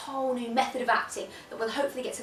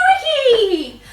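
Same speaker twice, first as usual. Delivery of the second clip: high, loud EastEnders-style yell; shout; 11 semitones higher, 13 dB louder than usual. Shown as a demonstration. Shouting at Ricky from EastEnders, high yell. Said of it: "jump up and shout".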